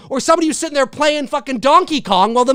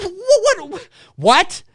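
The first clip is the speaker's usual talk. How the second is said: ordinary talk, nothing marked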